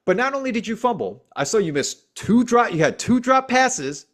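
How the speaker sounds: high, rising exasperation; heated rant. Real, not acted. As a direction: build the frustration toward the drops.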